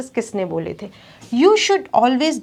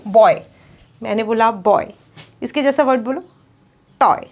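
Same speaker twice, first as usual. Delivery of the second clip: rhyme drill. Shown as a demonstration. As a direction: model each rhyming word for the class to repeat. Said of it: "speak after the teacher".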